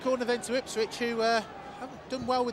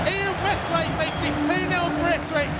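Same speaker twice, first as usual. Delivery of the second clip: triumphant call of the goal; excited goal call; 4 semitones higher than usual. Real, not acted. Goal call in live commentary.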